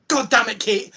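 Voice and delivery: exasperated outburst. Retold or imitated. Viewer reacting as Kate gets dragged in, exasperated outburst.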